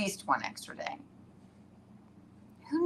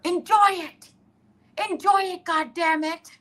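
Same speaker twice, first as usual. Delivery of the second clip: high, louder mock yell; 12 semitones higher, 6 dB louder than usual. Yelling at Amy on the boat, high mock yell. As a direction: yell it at her over the roar. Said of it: "I'm yelling at her".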